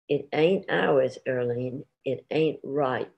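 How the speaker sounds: slow, weighty objection; slow and firm. Acted. Husband telling his wife the love seat isn't theirs, slow and firm. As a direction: lay down each word, it ain't right.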